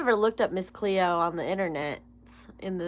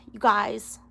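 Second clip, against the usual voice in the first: ordinary delivery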